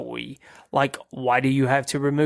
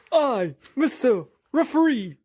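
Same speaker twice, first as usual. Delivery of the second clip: high, laughing referee voice; high-pitched, giggly Mister Referee; Mister Referee impression; 10 semitones higher than usual. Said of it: "I'm gonna try to do my best Mister Referee impression".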